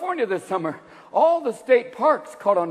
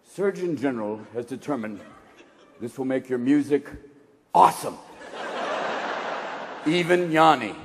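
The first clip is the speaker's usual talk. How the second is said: warning-label announcer; 7 semitones lower than usual. Retold or imitated. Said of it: "It's gonna have to say".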